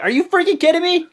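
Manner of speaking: loud, high, indignant imitation of a wrestler; mad outburst. Wrestler imitated protesting five challengers for his title, mad. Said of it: "he got mad".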